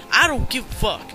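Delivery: normal talking voice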